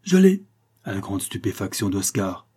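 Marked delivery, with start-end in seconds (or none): 0.0-0.5 high, loud triumphant shout; triumphant cry. A narrator's reading. Pontauchoux cries out to the crowd, triumphant shout. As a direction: blurt it out, he has found it. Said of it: "il s'écria".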